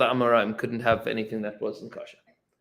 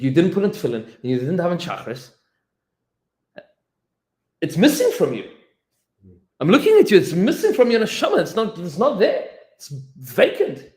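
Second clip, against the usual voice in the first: piercing rebuke; 6 semitones higher, 7 dB louder than usual. Retold or imitated. The Chabad confronts the Talmud.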